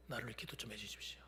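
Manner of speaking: soft unvoiced, low request; whispered plea. Pastor shyly asking small group for prayer, whispered.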